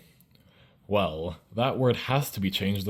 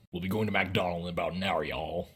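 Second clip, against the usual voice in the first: quick, low mock-American statement; fast, flattened mock-American, sinking on "y'all"; American declaration; 3 semitones lower, 5 dB quieter than usual. Shown as a demonstration. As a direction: rattle it off softly as a caricature American. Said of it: "Americans declare that you will be going".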